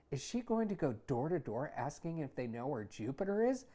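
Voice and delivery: usual speaking voice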